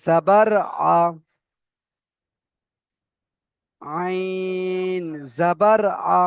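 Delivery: drawn-out AIN, "আইন" held long and level; spelling demonstration. Shown as a demonstration. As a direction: recite slowly, stretching "আইন" before "জাবার আ". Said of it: "তাহলে আমাকে পড়তে হবে".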